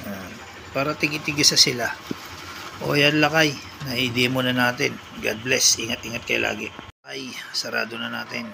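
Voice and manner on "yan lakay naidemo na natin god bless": ordinary delivery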